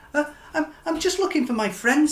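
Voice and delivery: high, quick, nervous little voice; Stanley. Acted. Stanley nervously answering the lobster, high and quick. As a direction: stammer it out fast and high. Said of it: "said Stanley".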